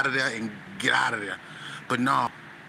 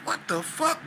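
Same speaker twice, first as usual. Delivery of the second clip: shocked; 14 semitones higher than usual. Retold or imitated. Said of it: "I'm like".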